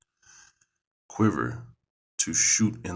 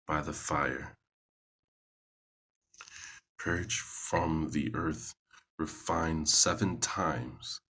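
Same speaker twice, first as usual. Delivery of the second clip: scripture reading. Acted. Reading a psalm aloud to listeners, solemn and measured. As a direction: read it out like scripture in church.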